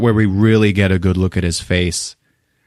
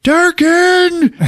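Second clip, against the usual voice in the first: very high shouted name; imitated drawn-out yell; exasperated yell; 4 dB louder than usual. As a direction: holler the name impatiently.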